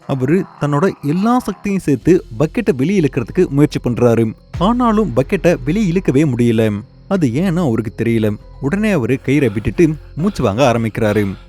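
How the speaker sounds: ordinary delivery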